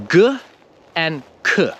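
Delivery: usual speaking voice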